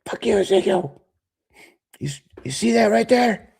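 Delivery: high, loud taunting voice; skit voice. Acted. Skit insult exchange.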